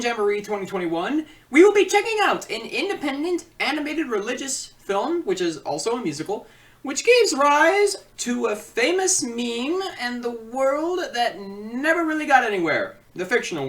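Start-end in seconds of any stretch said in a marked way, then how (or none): 1.5-13.0 high, louder teaser build-up; hyped host voice. Acted. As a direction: sell the film like a trailer. Hypes this week's film.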